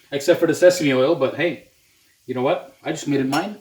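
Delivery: usual speaking voice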